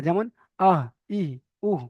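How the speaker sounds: আহ, ই, উহ breathed from the base of the throat; throat vowel demo. Shown as a demonstration. Teacher demonstrating throat sounds to students, breathy. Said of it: "শুরু হতে উচ্চারিত হয়".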